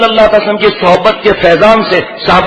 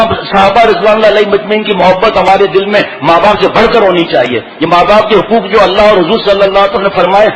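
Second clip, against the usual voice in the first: ordinary talk, nothing marked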